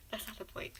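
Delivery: a quick hushed whisper; hushed aside. Real, not acted. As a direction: whisper it as a side comment. Aside to the audience.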